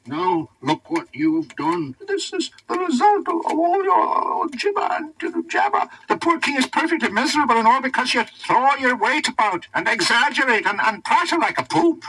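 high, cross scolding animal; crossly. A narrator's reading. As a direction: scold Merlin, high and quick. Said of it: "turned upon Merlin crossly".

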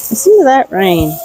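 ordinary delivery

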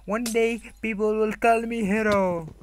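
loud, high hero-in-the-making voice; aspiring hero. Pretend. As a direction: proclaim it like a proud young hero. Voices a would-be hero's line.

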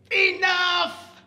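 high, loud shout of Enough!; fed-up shout. Acted. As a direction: snap awake and shout it out. Adam bolts up fed up.

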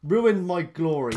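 drawn-out loud groan of protest; loud outcry. Real, not acted. Teammate steals his kill.

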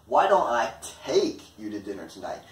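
"take" stretched and punched; stress on TAKE. Shown as a demonstration. Offering to take responsibility for transport, stress on "take". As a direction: lean hard on the word "take". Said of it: "the focus is on take".

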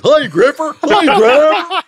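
very high, loud, laughing Southern drawl. Retold or imitated. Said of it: "we made them Southern rednecks".

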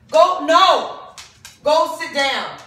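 loud firm command to a dog. Real, not acted. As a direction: tell the dog off sharply. Scolding dogs off the floor.

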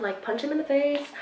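ordinary talk

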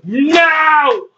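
high, wailing play "no"; mock anguished cry. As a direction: wail as if knocked out of the match. Wrestler eliminated in play match.

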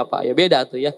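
normal talking voice

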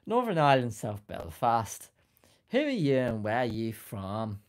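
hammed-up Belfast accent attempt; shaky-confidence go at a Northern Irish accent. Shown as a demonstration. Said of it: "referring to my Irish accent".

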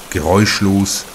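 ordinary delivery

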